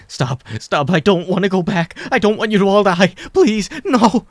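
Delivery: climbing high and loud pleading; desperate begging. A narrator's reading. Mike begs not to go back, climbing high and loud.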